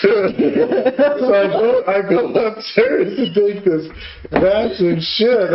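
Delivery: usual speaking voice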